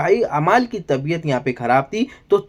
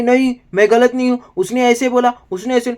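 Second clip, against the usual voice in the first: mock Kunika insisting; 8 semitones higher than usual. Kunika refuses to listen.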